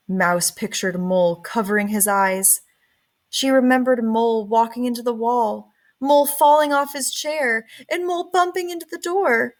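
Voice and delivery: plain reading voice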